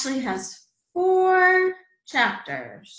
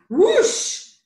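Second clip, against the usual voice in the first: high, loud drawn-out whoosh; whoosh of wind; 11 semitones higher, 8 dB louder than usual. A narrator's reading. Mouse opens the door to a gust, loud whoosh. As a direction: blow through like a big gust.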